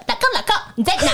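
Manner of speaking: high-pitched, hammed-up call to husband; playful wifely call. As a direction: put on a playful high voice, half laughing. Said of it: "哦，我应该说". (夸张高音地喊老公)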